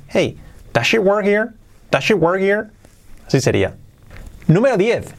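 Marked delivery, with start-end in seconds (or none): 0.1-2.7 linked question, lifted in pitch; natural English question melody; with intonation. Shown as a demonstration. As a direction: ask it fluently, raising "she work here". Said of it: "Con entonación".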